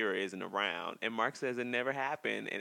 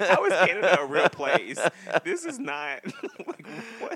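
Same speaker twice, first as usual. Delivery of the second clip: real laughter, higher and louder; laughing; 7 semitones higher, 9 dB louder than usual. Real, not acted. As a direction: laugh through the words in disbelief.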